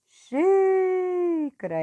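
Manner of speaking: "Xícara" with a very long, high, loud XÍ; exaggerated teacher's stress on the XÍ of "Xícara"; stressed syllable. Shown as a demonstration. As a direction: hold and belt the first syllable of "Xícara". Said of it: "essa é a que eu pronuncio com mais força".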